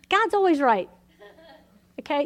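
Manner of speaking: usual speaking voice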